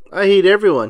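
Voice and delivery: flat robotic monotone. A narrator's reading. Squidward taking the robot's place, mock robot voice. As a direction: say it like a machine. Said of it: "a robot voice".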